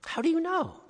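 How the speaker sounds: put-on boy startled by the voice's claim; amazed question. A narrator's reading. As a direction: ask in sudden wonder.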